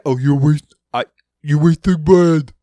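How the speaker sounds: imitating viewers telling him off for wasting bread; mock scolding viewer. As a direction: whine it like a disapproving viewer. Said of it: "I hear you all now".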